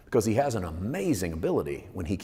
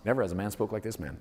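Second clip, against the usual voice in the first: fast, softer throwaway quote; brisk offhand quote; quick recap; 4 dB quieter, faster than usual. Shown as a demonstration. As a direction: rattle it off as a reminder.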